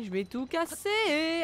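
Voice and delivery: slow, high, drawn-out threat; mock threat. Real, not acted. Player vowing to smash the enemies, slow mock-menacing. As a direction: stretch it out like a playful threat. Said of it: "je suis énervée".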